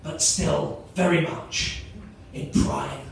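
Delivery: slow, measured sports commentator voice; main commentator. Acted. Comedian voicing his imagined commentator as he warms up, measured commentary. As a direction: introduce an athlete with slow, spaced-out phrases. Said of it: "the first commentator will kick in".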